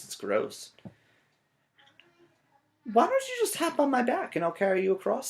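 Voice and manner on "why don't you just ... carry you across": high, sweet-talking offer; sly fox offer. Acted. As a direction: coax him onto your back. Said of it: "fox offers a ride".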